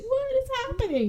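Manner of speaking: high-pitched squeal of alarm; hammed-up panic, squeaky and high; mock alarm. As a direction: act out shocked bewilderment in a high voice. Stabbed man reacts in retelling.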